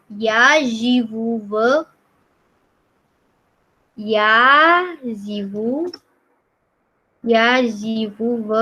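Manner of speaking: "Я живу в" stretched word by word; slow language demo. Shown as a demonstration. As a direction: draw out "Я живу в" slowly. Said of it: "Kamu jawab".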